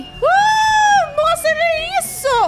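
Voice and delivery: startled shout. Real, not acted. Guards arrive, shock.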